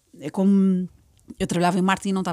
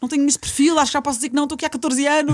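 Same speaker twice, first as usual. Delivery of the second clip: retold protest; 6 semitones higher, faster than usual.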